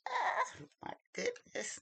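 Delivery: high, bright gasp of surprise on ah; mock surprise. Retelling her reaction to his text, mock surprise. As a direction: act out your shock when he says he's coming. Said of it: "I was like".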